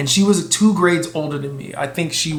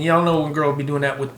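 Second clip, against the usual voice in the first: ordinary talk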